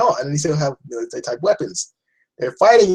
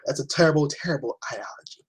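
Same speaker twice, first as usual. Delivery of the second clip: normal talking voice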